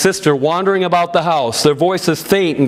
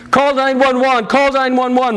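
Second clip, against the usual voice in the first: higher, urgent repeated plea; insistent panic; 8 semitones higher than usual. A narrator's reading. Mother urging the family to phone for help, panicky and higher. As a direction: keep pressing the same order in a panic. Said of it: "my mom kept insisting".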